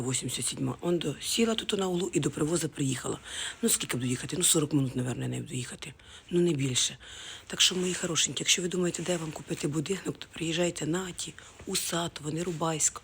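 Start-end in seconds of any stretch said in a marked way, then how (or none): none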